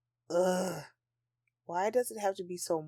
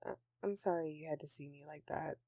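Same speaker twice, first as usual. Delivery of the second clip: sheepish apology; 3 semitones lower, 9 dB quieter than usual. Acted. Apologizing after outburst.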